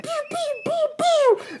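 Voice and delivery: high mouth-made laser zaps; laser gun noises. Pretend. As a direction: zap the aliens with high pews. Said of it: "picks up a gun and is like".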